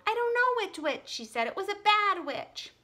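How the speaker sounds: high whiny complaint; upset girl. Acted. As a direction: complain to the teacher near tears. Susan upset to teacher.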